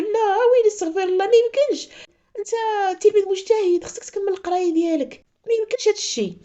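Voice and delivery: high, pleading, close to tears; upset pleading. Retold or imitated. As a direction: beg him in a high, upset voice. Sister upset, pleading with the boy. (توسل حزين بصوت عالي)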